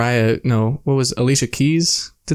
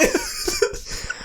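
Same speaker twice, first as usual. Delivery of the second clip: a squeaky burst of laughter way up high; high laughing squeal; 9 dB quieter than usual. Real, not acted. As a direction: squeal as you crack up. Laughing fit.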